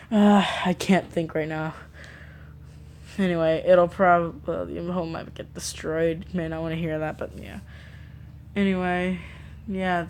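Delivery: ordinary delivery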